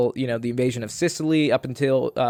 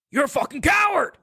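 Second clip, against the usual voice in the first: much higher, shouting the insult at the soldier; furious shout; 14 semitones higher than usual. Retold or imitated. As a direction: scream it, disgusted, full force. Patton berating crying soldier, retold.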